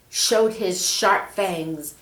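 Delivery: ordinary delivery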